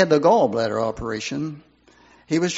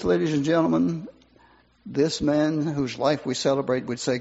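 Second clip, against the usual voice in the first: usual speaking voice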